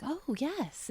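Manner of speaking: soft, high-pitched relish; acted blissful relish. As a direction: sink softly into a pleasing thought. Acting out delight at soothing image.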